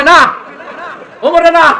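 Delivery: loud, high-pitched protest; frightened refusal. Acted. Aisha refusing Omar as mediator, loud frightened protest. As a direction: refuse in loud, acted alarm. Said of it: "আমার ডর লাগে".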